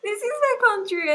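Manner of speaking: high, loud retold protest; indignant protest. Answering the street heckler, amused indignant protest. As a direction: insist on it in amused disbelief.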